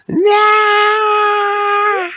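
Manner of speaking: very high, loud, sustained shriek; mock scream. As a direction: scream it long and loud for fun. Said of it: "We just like screaming".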